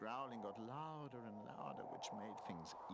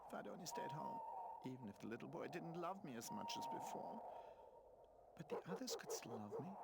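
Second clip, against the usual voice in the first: crying; 9 semitones higher than usual. A narrator's reading. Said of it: "he slowly cried himself to sleep".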